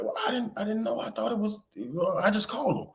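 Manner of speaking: high, stammering excuse-making; flustered daughter. Retold or imitated. Daughter explains to dad about the lost sweater, flustered imitation. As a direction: imitate a kid fumbling for an excuse.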